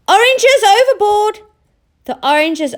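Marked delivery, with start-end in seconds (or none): 0.1-1.4 very high loud shout; panicked shout. Acted. Oscar seeing oranges drop into the sea, loud high shout. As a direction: cry out in alarm.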